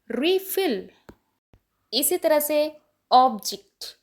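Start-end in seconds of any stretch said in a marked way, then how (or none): 0.1-0.9 refill with FILL stressed and lifted high; refill: second syllable hit high; verb stress, second syllable. Shown as a demonstration. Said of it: "तो difference देखो".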